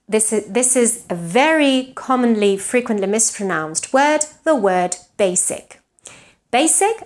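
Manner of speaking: ordinary talk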